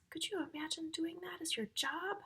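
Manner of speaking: high, hushed whisper. Acted. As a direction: whisper it like a shared secret. Teacher whispers question to children.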